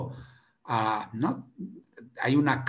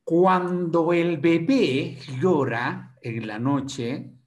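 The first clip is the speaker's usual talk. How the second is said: slow storytelling; 4 semitones higher, 5 dB louder, much slower than usual. Acted. Opening line, slow and far.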